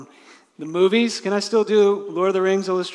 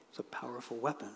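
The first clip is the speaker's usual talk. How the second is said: softly repeated; 4 semitones lower, 15 dB quieter, faster than usual. Real, not acted. Preacher echoes the line softly.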